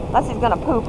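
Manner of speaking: ordinary talk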